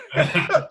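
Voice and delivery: high, breathy burst of laughter; a real laugh at the thought of staying for life. Real, not acted. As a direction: let a sudden laugh break out.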